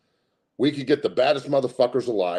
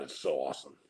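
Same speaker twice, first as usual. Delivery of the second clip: gloating hush; 10 semitones lower, 11 dB quieter than usual. Real, not acted. Gloating whisper.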